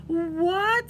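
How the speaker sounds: high, loud rising what. A narrator's reading. Froggy shouting back to his mother, high and loud. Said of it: "said Froggy".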